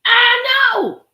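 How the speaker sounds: high, loud cry of disbelief; anguished shout. Real, not acted. Reacting to the six-year affair, a loud anguished cry. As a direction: wail it out in shock.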